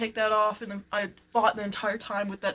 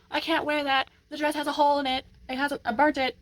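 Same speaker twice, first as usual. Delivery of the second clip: lightly voiced cartoon girl, higher pitch; a hint of a higher character voice; fussing cartoon girl; 6 semitones higher than usual. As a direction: mimic her protest with a raised pitch. Retelling a cartoon girl fussing about outfit.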